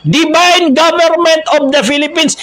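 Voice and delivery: high, sustained slogan call; rallying slogan cry. Shown as a demonstration. Preacher shows protesters what to shout, rallying slogan cry. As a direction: call it out as a chant for the crowd. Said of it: "Yung dapat isigaw".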